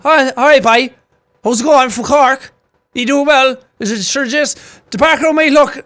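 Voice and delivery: high, loud Cork sing-song; Cork Irish accent. Retold or imitated. Streamer impersonating lads from Cork, Cork accent. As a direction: exaggerate the Cork lilt in imitation. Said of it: "they're from Cork".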